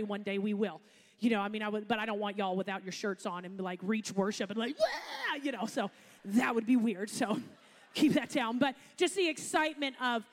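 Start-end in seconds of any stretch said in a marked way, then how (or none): 4.8-5.4 a sudden very high whooping yell; crowd whoop. Retold or imitated. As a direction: imitate a crowd going wild, half joking. Mimicking a wild worship crowd.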